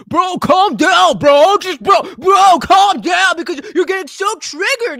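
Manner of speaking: hammed-up shouting at a chatter; mock yell. Mock-yelling at chatter to calm down.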